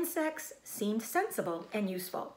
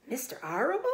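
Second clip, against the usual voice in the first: sobbing; 6 semitones higher, faster than usual. Acted. Wilbur sobbing about Fern's father, high. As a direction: choke it out in disbelief. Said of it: "sobbed Wilbur".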